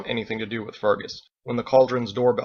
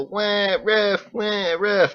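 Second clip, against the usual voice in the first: high whiny mock crying at the ref; mock crybaby; 9 semitones higher, 4 dB louder, much slower than usual. Ditch mocking his crying opponent, high and whiny. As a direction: whine like a bawling kid. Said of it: "He's a".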